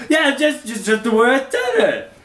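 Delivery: high, loud whiny mock voice; mocking imitation. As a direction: parody a smug friend's gloating. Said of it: "I'm gonna prove you wrong and be like".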